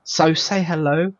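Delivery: usual speaking voice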